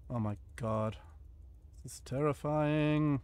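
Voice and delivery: ordinary talk, nothing marked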